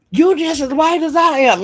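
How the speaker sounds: high, mocking voice of the man; quoting the man. Retelling the man to Rick, mocking high voice. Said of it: "the man say".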